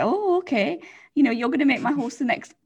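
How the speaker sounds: airy, high, sing-song mock agreement; mocking gullible voice. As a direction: play the naive horse rider, brushing it off. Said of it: "we're like".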